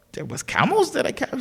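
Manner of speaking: high-pitched, softly baffled question; puzzled surprise. Acted. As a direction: act taken aback, pitch jumping up. King surprised about captured camels.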